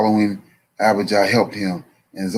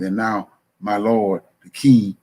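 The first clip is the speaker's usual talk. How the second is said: ordinary delivery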